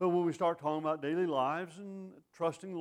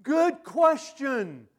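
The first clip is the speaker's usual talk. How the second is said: booming, much higher, very slow; preaching shout; 10 semitones higher, 12 dB louder, much slower than usual. Acted. Preacher answering the congregation's question, booming slowly. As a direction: proclaim it to the back pew.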